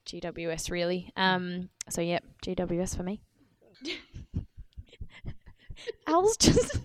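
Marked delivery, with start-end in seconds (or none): 3.8-6.8 laughing. Real, not acted. Friend suddenly gets nosebleed.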